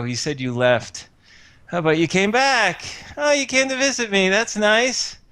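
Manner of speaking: high, groaning sickbed voice; moaning invalid. Acted. August greeting visitors from his deathbed, moaning in mock pain. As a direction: whimper through fake back pain, hamming it up. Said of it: "And he goes".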